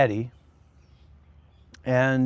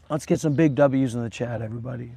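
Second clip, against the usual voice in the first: usual speaking voice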